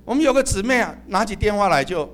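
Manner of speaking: usual speaking voice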